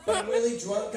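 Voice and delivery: ordinary talk, nothing marked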